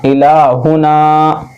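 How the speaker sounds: इलाहुना with ला and ना stretched, हु short; slow, measured model reading of इलाहुना; drawn-out recitation. Shown as a demonstration. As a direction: hold the aa vowels, keep हु quick. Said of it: "खींच कर पढ़ेंगे".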